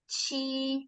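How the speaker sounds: "Qi" said high and level as Mandarin first tone; "Qi" high and steady, no rise or fall. Shown as a demonstration. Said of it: "the high and flat pitch".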